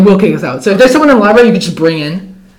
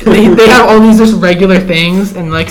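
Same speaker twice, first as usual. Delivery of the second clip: ordinary talk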